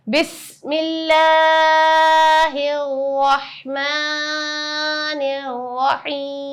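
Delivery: slow, drawn-out melodic tilawat; Quranic recitation. Acted. Teacher opening her class with a slow recitation. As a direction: stretch each word into a chant.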